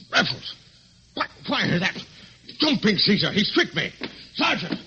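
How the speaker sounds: high, loud alarmed yelling; shouting after the escaped prisoner, loud and frantic. Acted. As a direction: bellow for the sergeant in a panic. Inspector discovers escape, shouts for sergeant.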